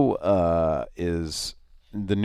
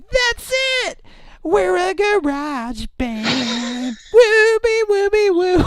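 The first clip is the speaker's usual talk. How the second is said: high, loud shouted cheer; excited shout; 7 dB louder, slower than usual. Retold or imitated. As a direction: yell it like a kid overjoyed. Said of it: "They're like".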